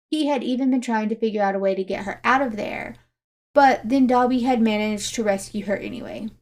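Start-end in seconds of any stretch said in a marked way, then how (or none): none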